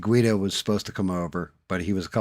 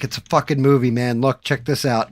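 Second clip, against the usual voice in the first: loud; 6 semitones higher, 9 dB louder than usual. Retold or imitated. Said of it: "He's like".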